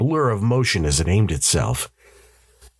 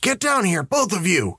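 higher-pitched, urgent bark at no extra volume; barked order; 13 semitones higher than usual. A narrator's reading. Father hurrying his twins downstairs, barking. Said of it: "Ed barked".

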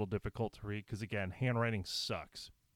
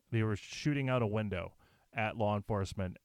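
ordinary talk

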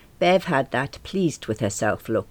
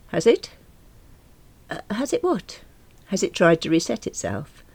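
a hint of a higher, brisk tradesman; lightly voiced window cleaner; 7 semitones higher than usual. A narrator's reading. Window cleaner asking about the computer, lightly voiced. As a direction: voice him lightly.

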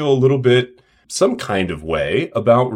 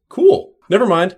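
higher, louder, breezy brush-off; mock breezy patient; 8 semitones higher, 4 dB louder than usual. Imagined patient giving up the complaint, breezy mock voice. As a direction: toss it off like a sudden convert.

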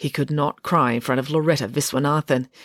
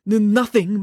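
plain reading voice

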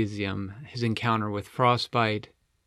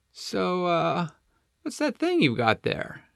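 lightly higher friendly penguin; easygoing penguin buddy, a bit higher; Flippo; 8 semitones higher than usual. A narrator's reading. Flippo asks.

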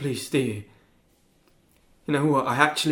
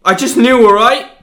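much higher and louder, shouting; angry outburst; 9 semitones higher, 19 dB louder than usual. Acted. Teen shouts back at his lawyer, angry. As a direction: lash out defensively. Client snaps under questioning.

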